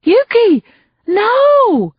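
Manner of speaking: high, loud, desperate scream. A narrator's reading. Reporter screams a warning at Yuki, high and loud. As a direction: scream a warning as she steps into traffic. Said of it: "Candy Stimpson screamed".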